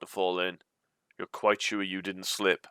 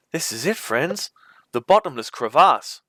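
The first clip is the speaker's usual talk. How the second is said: excited announcement; 6 semitones higher, 7 dB louder than usual. A narrator's reading. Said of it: "a broad smile on his face".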